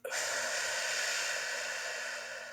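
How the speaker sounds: slow, deep audible breath out; breathing demo for viewers; long exhale. Shown as a demonstration. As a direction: let the air go slowly and fully.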